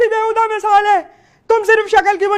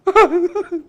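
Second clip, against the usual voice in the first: short bursts of derisive laughter; scoffing laughter; mocking laugh. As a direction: laugh off your mother's idea. Daughter laughs at mother's demand.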